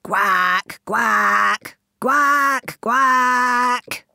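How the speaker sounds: long, loud, drawn-out quacks rising; a father duck booming his call across the pond; daddy duck quacking. A narrator's reading. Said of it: "taking a big deep breath".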